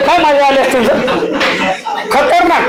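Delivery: half-joking excited exclamation; excited laugh. Real, not acted. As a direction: blurt it out, laughing. Joking about a rocket launcher.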